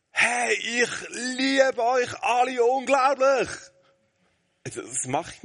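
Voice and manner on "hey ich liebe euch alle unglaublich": loud, high, slow joyful yell across the garden; mock shout. Shown as a demonstration. Father yelling love to family in the garden, hammed-up shout. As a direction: bellow it like an embarrassing dad. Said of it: "Ich brülle nicht".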